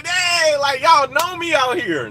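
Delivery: high, pleased dang; delighted that people know him; pleased surprise. Retold or imitated. Telling the fan he is glad to be known, pleased surprise.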